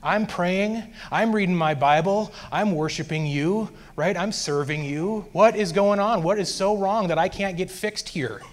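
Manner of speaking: desperate, fed-up appeal retold; exasperated pleading. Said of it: "I was just pleading to Jesus".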